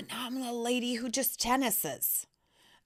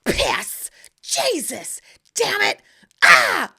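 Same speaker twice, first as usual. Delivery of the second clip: much higher, building to a yelled curse; cursing each swing; 8 semitones higher, 9 dB louder, slower than usual. Retold or imitated. On the tennis court she swears at every swing, ending in a shout. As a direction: spit out curses like tics. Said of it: "I have Tourette's".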